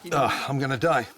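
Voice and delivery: gasping through a burning mouth; nose running, mouth on fire; mouth burning from spicy food. Real, not acted. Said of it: "my mouth is burning".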